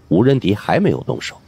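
ordinary delivery, nothing marked

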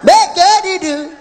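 loud slow high call-out. Shown as a demonstration. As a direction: call it out for the crowd to answer. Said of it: "Everybody say".

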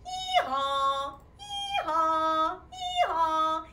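singsong hee-haw; donkey bray. Shown as a demonstration. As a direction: bray like a donkey for little children. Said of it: "the donkey says".

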